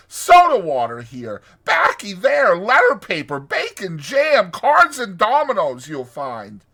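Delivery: high, loud, proud listing of treasures; triumphant. A narrator's reading. Toad pulling open a locker for his friends, triumphant. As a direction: reel off each item grandly as the boastful toad. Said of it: "said the toad triumphantly".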